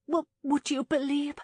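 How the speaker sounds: high, loud stammering start; flustered, high-voiced young woman; nervous. A narrator's reading. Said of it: "she said nervously".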